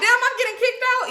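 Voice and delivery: high-pitched incredulous inner voice; mock disbelief. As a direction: squeal the thought in mock shock. Mock disbelief at being kicked out.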